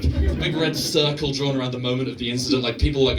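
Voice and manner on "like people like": ordinary delivery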